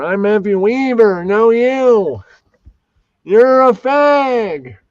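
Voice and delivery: high, loud put-on voice; Matthew Weaver character. Co-host playing an absent character, high mocking put-on voice. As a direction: push it way up high, drawing out the end. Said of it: "he would say that".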